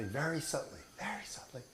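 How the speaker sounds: breathy whisper, rising high; a whispered very subtly. Shown as a demonstration. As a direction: hush it to a whisper.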